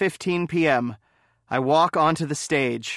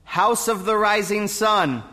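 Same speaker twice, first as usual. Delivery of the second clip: higher, raised voice to be heard; speaking up; 5 semitones higher than usual. Acted. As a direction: repeat it up and out so the judges hear. Can you speak up?.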